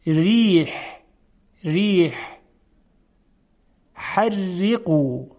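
thin, delicate ر before i in «ری»; light, fine r in «رزق» and «ریح»; ترقیق. Shown as a demonstration. («ری، رزق، ریح» با رای نازک و ظریف)

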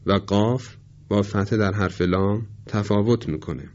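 ordinary talk

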